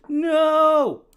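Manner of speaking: sudden high, loud cry; shouted no. Real, not acted. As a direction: yell it out in dismay. Losing on time, cries out.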